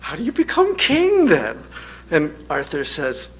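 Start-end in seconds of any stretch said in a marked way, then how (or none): 0.0-1.6 high, pert peasant woman's challenge; cheeky peasant question. Retold or imitated. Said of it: "And she says".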